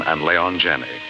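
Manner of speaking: ordinary talk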